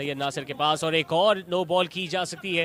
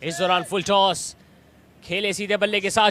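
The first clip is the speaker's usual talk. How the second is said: usual speaking voice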